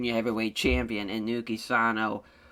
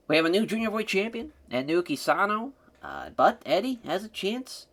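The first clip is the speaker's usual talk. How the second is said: excited; 7 semitones higher than usual. Real, not acted. Announcing the new champion.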